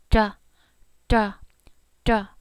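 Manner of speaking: da with the tongue tip curled back and flicked off the ridge; retroflex da. Shown as a demonstration. Teacher showing the curled-tongue da. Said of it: "curl that tip of the tongue back".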